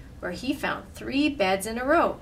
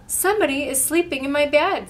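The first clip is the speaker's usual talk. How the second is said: high, raised little-bear voice; little bear sounding the alarm, pitched up; wee little Baby Bear voice; 5 semitones higher, 5 dB louder, faster than usual. A narrator's reading. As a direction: squeal it as a small storybook bear. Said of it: "in his wee little voice".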